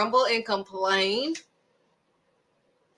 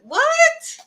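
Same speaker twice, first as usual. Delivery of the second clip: sudden squeak of disbelief, much higher and louder; shocked what; 6 dB louder than usual. Pretend. Preacher mocking the grumbling Israelites, squeaky shocked disbelief. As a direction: leap way up in mock outrage.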